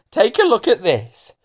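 high-pitched invitation; lightly voiced schoolgirl. Pandora shows Hamish the mirror, lightly girlish. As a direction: pitch it up like a pupil.

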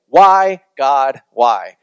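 loud exasperated cry to God; fed-up, emphatic appeal; frustrated. Retold or imitated. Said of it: "in a very frustrated way".

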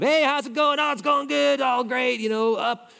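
flippant small talk. Retold or imitated. Preacher mocks shallow everyday greetings, flippant and high. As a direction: toss it off like empty chit-chat. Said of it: "flippant of".

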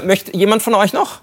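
ordinary talk, nothing marked

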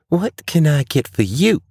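high, perky waitress asking for his order. A narrator's reading. Waitress offering the stranger a drink, high and chirpy. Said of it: "the waitress".

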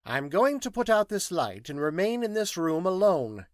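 high, loud, emphatic scientist; precise, high-pitched orders; formal instructions. Acted. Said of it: "gave some formal instructions".

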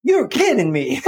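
high-pitched, incredulous sweet granny; sweet grandma. Retold or imitated. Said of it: "She just sounded like a grandma".

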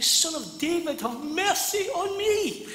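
loud, very high pleading shout; crying out. Acted. Blind Bartimaeus calling to Jesus for mercy, crying out. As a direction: scream it at the top of your voice, stretching the last words. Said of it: "He's crying, screaming, top of his voice".

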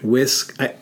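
ordinary talk

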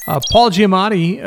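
normal talking voice

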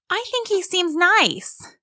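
put-on high little-girl voice; high-pitched, earnest kid; protesting child. A narrator's reading. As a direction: protest as a small child standing up for a friend. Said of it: "Kalia protested".

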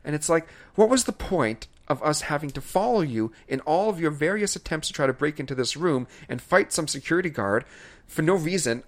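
normal talking voice

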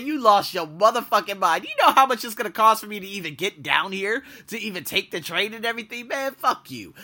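hammed-up telling-off of a cheap boss; cussing out the boss who offered three fifty, mock angry; retold angry rant.

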